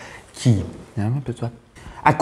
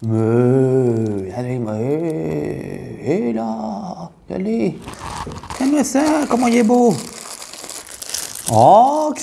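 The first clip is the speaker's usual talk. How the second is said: gushing; 5 semitones higher, much slower than usual. Acted. Gushing over cake, high voice.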